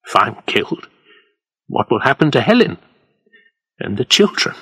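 ordinary delivery

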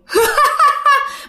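high, echoing creepy cackle; eerie ghost laugh. Retold or imitated. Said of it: "tiếng cười vang mà nó kinh dị". (tiếng cười ma quái rùng rợn, giọng rất cao)

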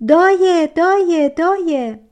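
high, loud cry that fades with each repeat; loud call. Acted. Girl at the pool loudly calling the giant's name. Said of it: "با صدای بلند گفت".

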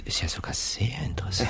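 leise geflüstert; flüster es neugierig vor dich hin. Acted. Leiser Kommentar für sich. (neugierig geflüstert)

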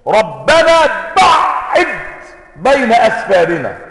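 صيحة دعاء مرتفعة وبطيئة; ادعُ بإصرار وصوت عالٍ; دعاء بإلحاح. Retold or imitated. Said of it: "ثم دعوا وشددوا". (دعاء ملحّ بصوت مرتفع وتمهّل)

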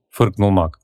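plain reading voice

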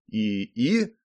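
tentative, questioning; uncertain. A narrator's reading. Hero addressing the sudden little man, uncertain. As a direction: ask it hesitantly, unsure what you see. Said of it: "неуверенно произнёс я".

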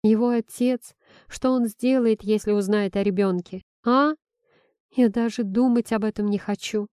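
plain reading voice

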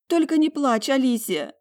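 high, loud, upset begging; anguished plea. A narrator's reading. Talia begging her sister not to cry, anguished. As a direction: plead with a tearful edge. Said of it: "выдохнула она".

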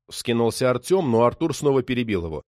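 ordinary delivery

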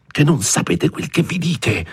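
irate, urgent stage whisper; hushed anger. A narrator's reading. Frightened priest scolding his housekeeper about soldiers, hushed and irate. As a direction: scold furiously without letting anyone overhear. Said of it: "rispose con voce bassa ma iraconda".

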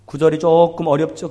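ordinary talk, nothing marked

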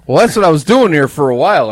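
loud pained strained words; cramp pain outburst. Real, not acted. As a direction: blurt it out as the cramp bites. Leg cramp hits mid-sentence.

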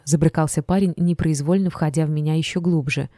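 ordinary delivery, nothing marked

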